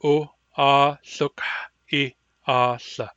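drawn out, unhurried back H; extremely slow model. Shown as a demonstration. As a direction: take all the time the word needs. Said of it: "don't rush the word".

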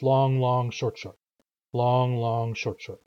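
each long stretched, short short clipped together; long-long-short-short rhythm. Shown as a demonstration. Teacher demonstrating the rhythm, long held and short quick. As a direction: hold long, long, then drop into quick short, short. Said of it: "notice when I say the rhythm".

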